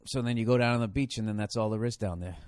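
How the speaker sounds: usual speaking voice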